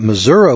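Missouri said as "mi-ZUR-uh"; local pronunciation. Shown as a demonstration. Yankee mimicking locals, Missouri as "Mizzourah". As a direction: end Missouri on "uh", as if spelled with A. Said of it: "If we wanted to pronounce that".

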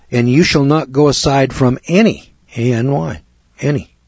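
any stretched and leaned on, then spelled A N Y; any said heavy, spelled out, said again; stress on any. Shown as a demonstration. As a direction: underline the word any with the voice. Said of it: "I'm gonna underline that word any".

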